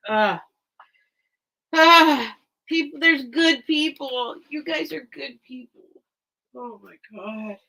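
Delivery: real happy tears, voice high and pushed up; choked up, pouring out gratitude; crying happy tears. Real, not acted. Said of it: "That's what's making me cry".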